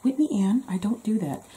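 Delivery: high-pitched, protesting mom using the full name; mock mom voice. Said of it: "And then she'll say".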